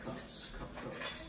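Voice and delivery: whispered under the breath; whispering. Real, not acted. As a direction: whisper the word to yourself. Muttering to self while measuring.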